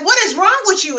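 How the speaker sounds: high, exasperated mock demand. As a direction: pitch it up like a fed-up partner. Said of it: "you'll be like".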